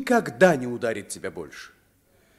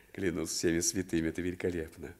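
amused laugh; 10 semitones lower, 8 dB quieter, much faster than usual. Acted. Смеётся над приказами мальчика.

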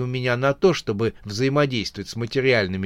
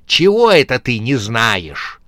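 menacing demand; 7 dB louder than usual. Acted. Corpse presses the frightened bandit.

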